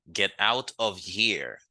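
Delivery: every word of Get out of here drawn out slowly; slow model phrase. Shown as a demonstration. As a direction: spell it out slowly for the class. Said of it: "slow model phrase for learners".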